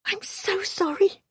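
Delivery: high, breathy, slow apology; drawn-out, high-pitched remorse; distressed apology. A narrator's reading. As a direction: apologise with aching concern. Wife apologises to injured captive husband.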